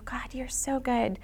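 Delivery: hushed, slow whisper of awe; a slow awed whisper to God; whispered prayer. Retold or imitated. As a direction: breathe it out in wonder. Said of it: "shake our heads and go".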